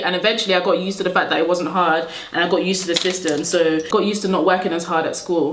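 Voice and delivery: usual speaking voice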